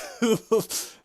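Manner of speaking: high, loud burst of laughter; amused laugh. Real, not acted. Vlogger laughing at the lawyer match reveal. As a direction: laugh out at the surprise twist.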